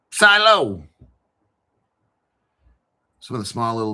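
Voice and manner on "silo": "Silo" said louder and higher, falling firmly; confident silo. Shown as a demonstration. Reading a coin name off the list, settling it loudly. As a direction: announce "Silo" like the answer.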